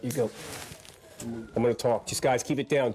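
normal talking voice

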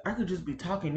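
ordinary talk, nothing marked

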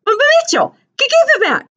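very high, frantic stuttering yell; panicked high-pitched boyish shout; stammering shout. Acted. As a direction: shout in panic, tripping on the first sounds.

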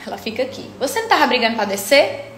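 ordinary delivery

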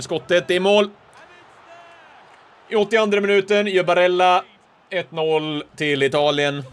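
loud, high commentator call; goal announcement. Real, not acted. Commentator announcing Barella's goal for Italy, loud formal call.

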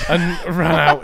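ordinary talk

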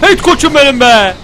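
loud, drawn-out high cheer; proud cheer. Real, not acted. Player cheering his own lad, proud loud whoop. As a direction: cheer your champion on, stretching the words.

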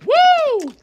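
high, loud whoop of delight; excited whoop. Real, not acted. Viewer thrilled by perfectly fried chicken, high whoop. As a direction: let out a joyful high woo.

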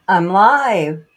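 high, loud laughing burst of excitement; bursting with joy at going live; so excited. Real, not acted. Streamer greeting her returning viewers, giddy and loud. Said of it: "I'm so excited".

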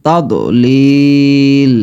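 তাদলিল with heavy throaty ta, slow. Shown as a demonstration. Teacher demonstrating heavy letter, slow তাদলিল. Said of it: "দালের মতো না হয়ে যায়".

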